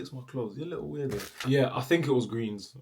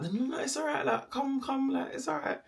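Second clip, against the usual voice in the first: little sweet voice. Retold or imitated. Calming a scared rabbit on the road, in a high sweet voice. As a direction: coax him gently, pitched way up. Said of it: "on the little sweet voice".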